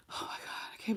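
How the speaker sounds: hushed whisper of someone ashamed; whispered regret. Pretend. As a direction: whisper it like you can't believe what you did. Voicing the sad person in the reading.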